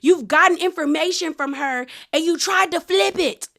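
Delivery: high-pitched imitation of a drunken yell; hammed-up, high accusing yell; mock yelling. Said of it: "now she's yelling, talking about".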